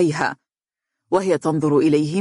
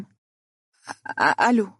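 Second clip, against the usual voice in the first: hesitant false starts, barely audible at first; stammering; 4 semitones higher, slower than usual. Acted. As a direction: stumble nervously into the hello. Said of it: "وقالت بصوت متلعثم". (بصوت متلعثم)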